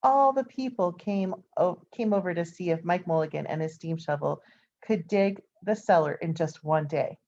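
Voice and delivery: ordinary delivery, nothing marked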